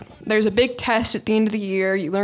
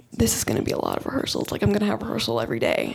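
rushed worried whisper; 4 dB quieter, faster than usual. Retold or imitated. Panicking over the rehearsal schedule.

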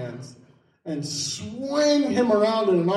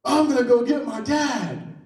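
higher-pitched kid's threat, mock yelling; retold yell; 6 semitones higher than usual. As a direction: imitate a boy yelling a threat. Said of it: "so his friend yells".

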